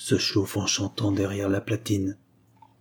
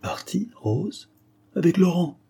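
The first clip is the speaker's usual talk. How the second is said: high, incredulous questions; shocked disbelief; 9 semitones higher than usual. A narrator's reading. Sick woman learning Rose left with Laurent, shocked disbelief. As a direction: echo the news in disbelief, pitch rising.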